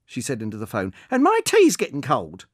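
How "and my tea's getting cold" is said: much higher, louder, put-upon; complaining old woman. Acted. Old woman telling the caller about the rain, complaining loudly. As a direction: whine about the weather.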